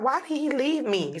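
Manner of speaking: put-on high child voice, hurt and asking; hurt little boy. Mother voicing her son asking why daddy left, high and hurt. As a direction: plead like an abandoned little boy. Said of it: "He was like".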